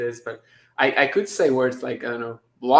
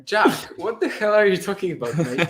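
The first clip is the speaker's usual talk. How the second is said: higher, mock London English accent; 7 semitones higher than usual. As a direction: scold Jack in a jokey London accent. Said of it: "sounded kind of, in, like, an English accent to me".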